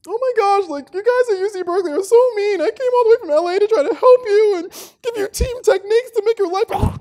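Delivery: hammed-up high, loud wailing; mock meltdown. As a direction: fake a tearful tantrum, very high and loud. Mock breakdown, falls on the ground.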